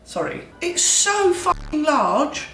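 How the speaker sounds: mock Gordon Ramsay cooking show voice; Gordon Ramsay impression. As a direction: rant like a hot-headed British TV chef. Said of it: "talk like Gordon Ramsay the whole time".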